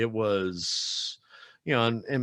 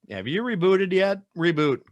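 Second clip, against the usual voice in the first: much higher, louder "Have you rebooted yet?"; impatient tech support; 10 semitones higher, 7 dB louder than usual. Retold or imitated. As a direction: brush the caller off, higher and louder. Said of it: "I'm usually like".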